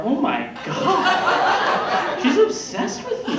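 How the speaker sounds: flattered panic; panicked awe. Retold or imitated. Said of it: "And I'm like".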